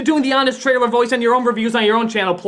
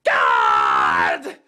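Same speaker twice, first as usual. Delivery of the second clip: exasperated cry. Real, not acted.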